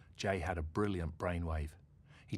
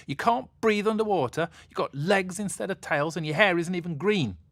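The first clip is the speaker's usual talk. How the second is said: angry yell; 11 semitones higher, 9 dB louder than usual. Acted. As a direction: yell it in a furious tantrum. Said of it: "she yelled, angrily".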